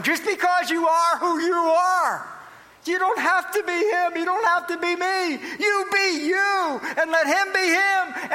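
high, ringing pulpit exhortation; preacher building to a high, drawn-out charge; impassioned preaching. Real, not acted. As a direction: lift the pitch and drive each phrase home. Preacher at full force.

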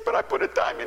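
very high-pitched mock crying; tearful man. Crying man insists to his pastor, high tearful imitation. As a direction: protest in an imitated wail. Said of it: "he was just a-crying".

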